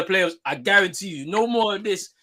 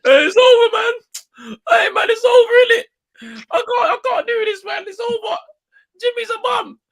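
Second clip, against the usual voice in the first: high, wailing, crying-out whine; mock crying fan; 10 dB louder than usual. Mocking his friend as a wailing, crying fan. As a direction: imitate a fan sobbing that the season's over. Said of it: "please don't start crying, bruv".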